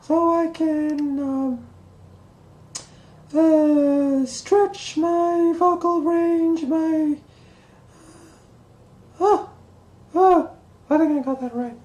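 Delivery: high cartoon mouse, slow and squeaky; Mickey Mouse voice. Shown as a demonstration. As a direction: falsetto Mickey Mouse impression. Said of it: "practice my Mickey Mouse voice".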